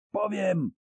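high, wailing cry of terror; howling. Acted. Sorcerer howling at the coroner in terror. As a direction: howl it out in fear. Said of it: "zawył czarodziej".

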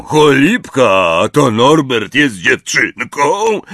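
a big friendly giant reminiscing; Hagrid's voice. A narrator's reading. Hagrid to Harry, fondly remembering.

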